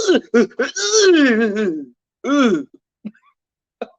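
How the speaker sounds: slow, drawn-out hammed-up moans; a slow, overdone fake moan; slow mock moan. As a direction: stretch each moan out like the lady on tape. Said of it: "all you could hear was the lady say".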